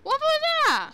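high, loud startled outburst. Real, not acted. Gamer spooked by something on screen, loud and high. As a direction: blurt it out in shock.

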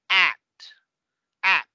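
"at" with throaty English a as in cat; native English pronunciation. Shown as a demonstration. Teacher using throat deliberately, native English vowel. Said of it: "usando mi garganta de cierta forma".

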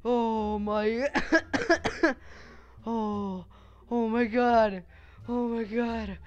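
high, loud, still shaken; breathless startled exclaim. Real, not acted. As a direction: catch your breath after a scare. Recovering from jump scare.

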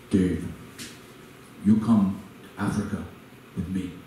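a hint of a Ghanaian mentor's accent on a late-night call. Ghanaian mentor phoning Dave at 3am to invite him, light Ghanaian accent. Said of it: "very thick Ghanaian accent".

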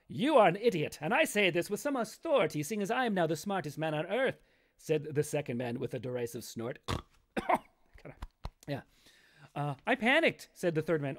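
measured storybook reading voice; story narration. A narrator's reading. Author reading his own grim fairy tale to viewers, storytelling voice. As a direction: read it like a fairy tale to listeners.

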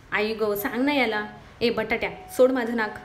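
ordinary delivery

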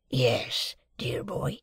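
a lightly voiced, hushed old convict; Magwitch the convict. A narrator's reading. Convict answering Pip at breakfast, lightly voiced and hushed. Convict figure in read novel.

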